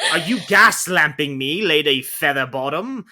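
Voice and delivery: loud, theatrical Victorian accusation; hammed-up Bridgerton melodrama; period drama voice. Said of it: "this is a Bridgerton plot".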